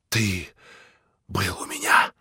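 harsh unvoiced rasping whisper; menacing rasped whisper; grating whisper. A narrator's reading. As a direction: grind the words out in a hoarse hush. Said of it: "скрежещущим шёпотом проговорил он".

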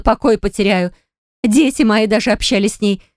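high-pitched agitated tirade, climbing; furious scolding. A narrator's reading. Furious sister berates her brother. (возмущённая тирада на высоких тонах)

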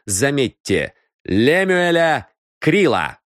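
stern detective. A narrator's reading. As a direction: spell out the inheritance claim to her face. Detective lays out the claim. (сыщик строго и с расстановкой излагает обвинение)

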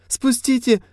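high whiny squeal; shriek. A narrator's reading. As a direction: squeal it in panic. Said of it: "взвизгнул подельник".

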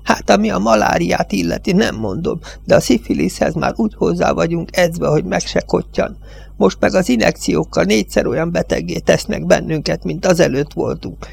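an aged judge railing against the Yankees; old village judge. Acted.

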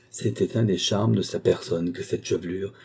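ordinary delivery, nothing marked

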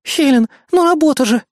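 high, apologetic protest; Kayli's guilty plea. A narrator's reading. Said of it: "виновато произнесла Кайли".

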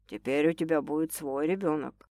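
low, soft statement; quiet, kind male voice; gentle. A narrator's reading. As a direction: remind her tenderly. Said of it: "мягко пояснил мужчина".

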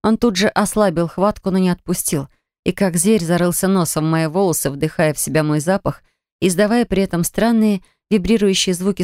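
ordinary delivery, nothing marked

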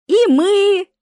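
very high, loud happy exclamation; overjoyed "us too!"; joyful reply. A narrator's reading. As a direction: burst out gleefully. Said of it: "радостно ответила Марина".

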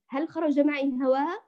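usual speaking voice

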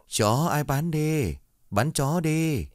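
put-on high, slow hawker's cry through an old loudspeaker; drawn-out street vendor call over a speaker. Dog buyer's loudspeaker calling out for dogs, high and slow. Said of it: "Âm thanh từ cái loa cũ".

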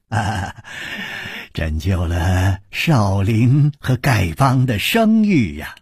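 ordinary delivery, nothing marked

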